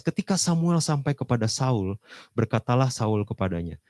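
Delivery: loud, high, ringing reading of Saul's boast; preaching at full force. Acted. Preacher reading Saul's words to the congregation, loud and high. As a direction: proclaim the verse to the whole hall.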